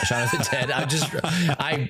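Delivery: high, laughing through the words; real giggles breaking up the sentence. Real, not acted. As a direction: crack up at your own slip. Cracks up at own misreading.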